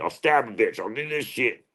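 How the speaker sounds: high-pitched mock threat; put-on crazy character. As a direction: play a wild character making fake threats, pitched well up. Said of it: "I can play a character".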